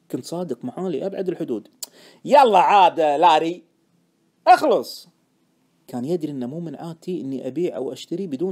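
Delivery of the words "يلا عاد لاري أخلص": loud, drawn-out, exasperated urging; impatient urging. Acted. Friend pressing Larry for the reason, impatient. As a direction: push him impatiently to get on with it.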